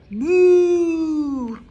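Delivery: loud high mooing cow; big cow moo. Acted. Librarian reading aloud booms the cows' moo. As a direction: belt out a cow's moo.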